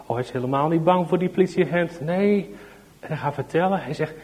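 ordinary delivery

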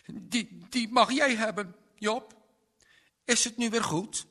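high, loud, halting plea; upset child offering a gift; tearful boy. A narrator's reading. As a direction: plead to make up, voice pitched high like an upset child. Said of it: "begon hij te huilen".

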